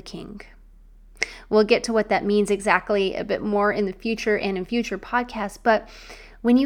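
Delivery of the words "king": the last word dropped to a whisper. Real, not acted. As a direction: let "King" land as a reverent whisper. Revealing daughter of the King.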